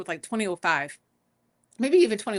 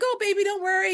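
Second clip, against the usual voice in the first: coddling family voice; 10 semitones higher, faster than usual. Retold or imitated. Voicing a nurturing family member.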